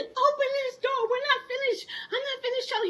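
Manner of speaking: high-pitched soprano yelling at him. Retold or imitated. Wife yelling high through the locked door at husband. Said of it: "I'm up here in high soprano".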